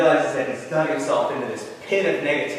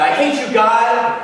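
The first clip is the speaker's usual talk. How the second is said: raised, high-pitched angry cry; high, loud outburst; screaming out in anger; 7 semitones higher, 5 dB louder, slower than usual. Acted. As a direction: act out a boy screaming at the sky. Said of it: "he screams out".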